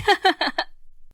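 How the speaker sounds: high villainous cackle; loud, gloating wicked-fairy laugh; evil laugh. Acted. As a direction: cackle in triumph. Villain fairy laughs after boast.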